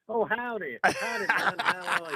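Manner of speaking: high, loud mock Texan; pretend Texan. Englishman mocking his own attempt at being Texan, fake Texan accent. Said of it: "pretend to be a Texan".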